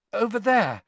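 high, uneasy answer; a touch of fright; shakily. A narrator's reading. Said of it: "said Hermione shakily".

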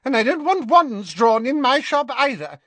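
put-on panicked shopkeeper warning the boys off; hasty Madame Malkin. A narrator's reading. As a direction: blurt it fast to stop a fight. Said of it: "she added hastily".

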